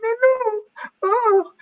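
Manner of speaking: put-on sing-song worry like a toddler soothing a baby; imitating toddler. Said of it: "She's like".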